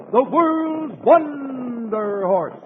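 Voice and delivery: normal talking voice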